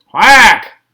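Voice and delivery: loud quacked reply; loud duck quack. A narrator's reading. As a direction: quack back loudly like a tired duck. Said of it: "The duck answered".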